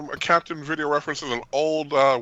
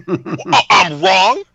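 loud, high challenge; indignant disbelief; 7 semitones higher, 10 dB louder, much slower than usual. Real, not acted. Co-host challenged on opinion of the lieutenant, indignant. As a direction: protest loudly, half laughing. Defends remark after others' wow.

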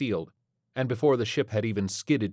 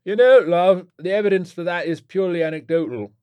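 loud, high rocker retort; aging British rock star; 9 semitones higher, 10 dB louder than usual. A narrator's reading. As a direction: argue like a wired old British rocker. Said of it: "Orlin wiped his nose vigorously".

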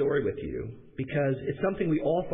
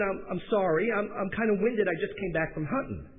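higher-pitched imitation Southern voice; southern accent; 4 semitones higher than usual. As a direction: play a Southerner on the phone. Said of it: "they spoke with a southern accent".

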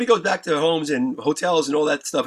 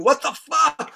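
startled outburst; 9 semitones higher, slower than usual. Retold or imitated. Acting out their startled reaction.

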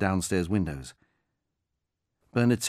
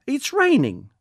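much higher, louder, put-upon; complaining old woman; 8 dB louder, slower than usual. Acted. Old woman telling the caller about the rain, complaining loudly. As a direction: whine about the weather.

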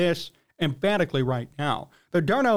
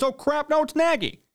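higher, sudden "oh crap" dawning; mock realization; 7 semitones higher than usual. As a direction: play the crowd's dumbfounded realization. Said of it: "Then everyone realized".

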